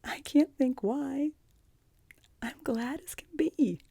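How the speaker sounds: put-on high, overwhelmed little-girl voice; pitched well up, puzzled by happy tears; bewildered. A narrator's reading. Anne learns she can stay, bewildered and overjoyed. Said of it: "in a tone of bewilderment".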